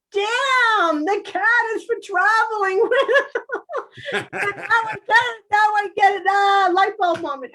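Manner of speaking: light up with the discovery; excited realization. Real, not acted. Realizing the cat is for astral travel, high and thrilled.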